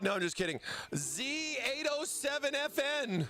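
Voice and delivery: ordinary talk, nothing marked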